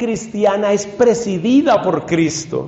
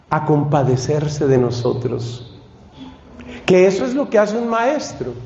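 ordinary talk

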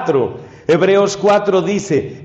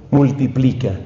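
ordinary talk

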